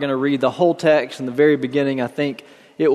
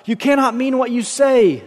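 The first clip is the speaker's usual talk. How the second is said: mild shriek; 9 semitones higher than usual. A narrator's reading. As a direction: shriek it in a restrained read-aloud way. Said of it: "the other shrieked".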